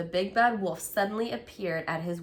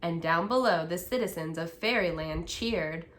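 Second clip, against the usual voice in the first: plain reading voice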